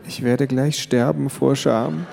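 ordinary delivery, nothing marked

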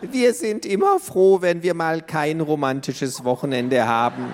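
put-on high old granny, chuckling at the end; a grandma with a wry joke. A narrator's reading. Grandma answers grandpa in a high old voice.

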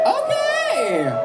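sassy high squeal of okay; high drawn-out okay. Acted. Drag host reacting.

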